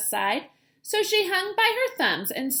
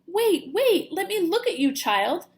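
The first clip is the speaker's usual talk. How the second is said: urgent call; 7 semitones higher, 6 dB louder than usual. Acted. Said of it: "mother bat spotting child".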